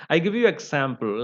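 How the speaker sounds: usual speaking voice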